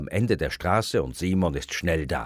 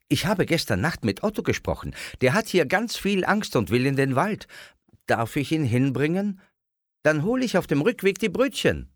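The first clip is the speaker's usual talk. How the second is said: fast, higher, eager rush of words; bubbling out fast; 10 semitones higher, 4 dB louder, faster than usual. A narrator's reading. Simon eagerly tells his parents about Otto, fast and higher. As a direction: blurt it all out in one breath. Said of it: "Ganz schnell sprudelt es heraus".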